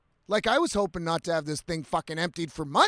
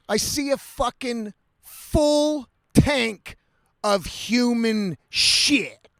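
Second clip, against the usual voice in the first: each word dragged out slow and heavy; drawn-out horrified reveal; slow disgusted emphasis; 3 semitones higher, 4 dB louder, much slower than usual. Real, not acted. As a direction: lean on full and human, slowly. Reveals the full tank.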